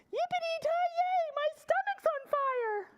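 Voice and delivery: squeaky high cowpoke yelp. Acted. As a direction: cry it out way up high like a burnt cowboy. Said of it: "his voice got higher".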